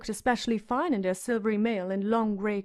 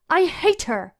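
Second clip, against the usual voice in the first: loud, higher cry of rage; scream; 6 semitones higher, 5 dB louder than usual. A narrator's reading. Sansa alone in her bedchamber, screaming in rage. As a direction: scream it alone in your room. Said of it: "she screamed".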